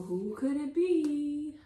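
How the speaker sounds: slow, high wondering; hammed-up suspense; mock suspense. A narrator's reading. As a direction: draw it out, a little spooked. Hears someone coming.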